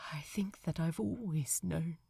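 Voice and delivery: choked whisper, voice cracking; barely holding back tears; cracked, thick voice. A narrator's reading. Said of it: "in a voice that was cracked and thick".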